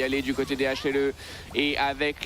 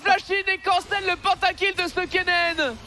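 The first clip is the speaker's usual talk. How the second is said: high, loud, slow indignant shout; outraged hype; 6 dB louder, slower than usual. Real, not acted. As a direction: shout the betrayal at the crowd. Penta cancelled, caster outraged.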